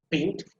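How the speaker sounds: BEAU of "beautiful" stressed, the rest barely uttered; stress on first syllable. Shown as a demonstration. Teacher showing which syllable is emphasized, stress on BEAU in "beautiful". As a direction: lean on the start of "beautiful", drop the ending. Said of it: "the first one that is emphasized".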